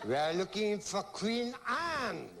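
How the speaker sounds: slow, drawn-out hint of a French accent; mock French accent. Shown as a demonstration. Host calling for the answer in a mock French accent. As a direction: call the answer like a hammy Frenchman.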